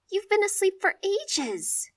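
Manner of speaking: high, slow tearful plea; sobbing. Acted. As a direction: speak through sobs. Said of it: "sobbing as she speaks".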